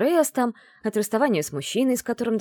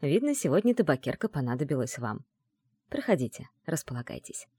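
ordinary delivery, nothing marked

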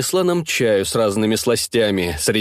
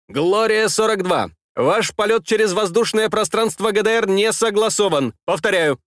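radio controller voice; 6 semitones higher than usual. Acted. Controller repeats warning.

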